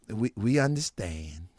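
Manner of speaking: low, soft, laughing reassurance; patronizing gentle. Retold or imitated. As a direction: humor him sweetly. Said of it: "The guy".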